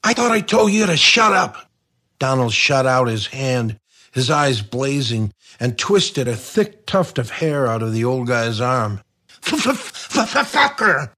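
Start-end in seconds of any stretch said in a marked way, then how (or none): 0.1-1.7 angry threat. Acted. Donald angry at the old man. 9.4-11.1 put-on a high stammered yell from a pained old man; stuttering holler. A narrator's reading. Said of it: "hollered the old man".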